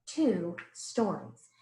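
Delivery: ordinary delivery, nothing marked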